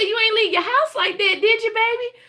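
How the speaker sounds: put-on high old Southern grandma voice. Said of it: "She said".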